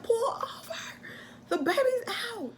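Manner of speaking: high-pitched desperate cry; frantic plea. Retold or imitated. Wife begging husband to stop the car, frantic. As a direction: plead with him, voice up high. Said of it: "I'm like".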